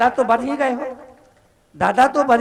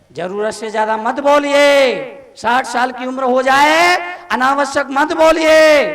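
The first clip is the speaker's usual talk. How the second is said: ordinary talk, nothing marked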